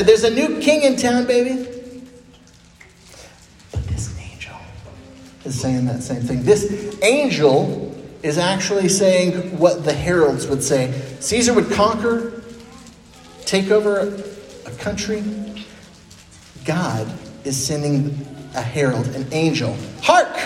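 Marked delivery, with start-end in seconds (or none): 0.0-1.7 excited proclamation. Retold or imitated. Herald announcing new king. 20.0-20.4 high ringing call of Hark; herald's cry. Retold or imitated.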